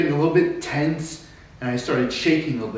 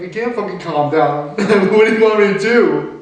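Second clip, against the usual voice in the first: high, loud exasperated yell with a laugh; mock-furious cry, much higher and louder; retold shout; 7 semitones higher, 8 dB louder than usual. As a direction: blow up at being told to calm down. Shouting back at the nurse.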